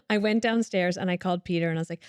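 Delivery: ordinary talk, nothing marked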